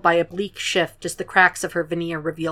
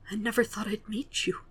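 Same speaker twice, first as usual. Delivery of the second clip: breathless whisper, barely voiced; 4 semitones higher, 11 dB quieter than usual. Grieving mother to Will, whispering. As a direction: hush it out in disbelief. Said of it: "she whispered".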